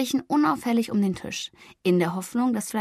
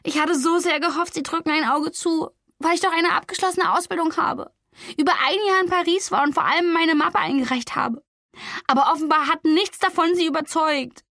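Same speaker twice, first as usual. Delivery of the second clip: put-on distraught young woman; 7 semitones higher than usual. A narrator's reading. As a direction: pour out the disappointment. Flori despairing over the rejection.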